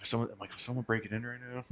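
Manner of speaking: hushed, quick self-quote; mildly paranoid. Retold or imitated. Retelling getting high after scary stories, mock paranoid and quiet. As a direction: imitate a softly spoken paranoid thought. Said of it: "I get, like, a little paranoid".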